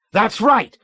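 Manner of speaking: high, loud triumphant shout; emphatic cry. A narrator's reading. Colonel shouting his agreement at the captain. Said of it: "cried emphatically".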